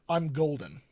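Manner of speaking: usual speaking voice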